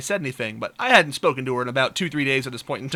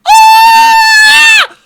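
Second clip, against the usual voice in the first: long, very high, loud scream; acted shriek; 18 dB louder than usual. Caught by his girlfriend, he shrieks in full voice. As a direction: let out a huge startled mock shriek. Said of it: "I, I, I, I shriek".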